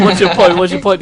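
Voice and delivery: mock demanding. Retelling a girl pestering Jordan, mock loud demand. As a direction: fire it at him like picking a fight.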